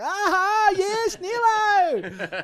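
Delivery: very high, loud, drawn-out laughing crow; mocking mate's cheer. Mate mocking his cheese eating with a laughing high crow. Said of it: "one of the boys is like".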